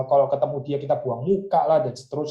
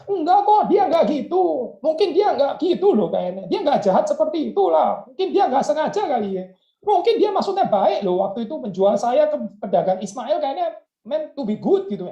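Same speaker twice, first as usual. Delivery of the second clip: much higher, airy denial with a laugh; naive positive thinker; 11 semitones higher than usual. Pretend. Preacher mocking a positive thinker excusing the brothers. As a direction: brush the hurt away brightly. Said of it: "Berpikir positif itu".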